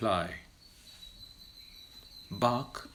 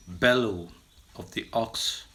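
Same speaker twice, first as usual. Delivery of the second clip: usual speaking voice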